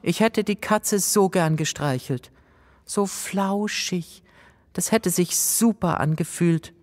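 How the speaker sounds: soft, wistful, slow on flauschig; disappointed sigh. A narrator's reading. Ella disappointed after the cat escapes, wistful. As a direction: sigh over the lost kitten. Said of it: "Ella seufzt enttäuscht".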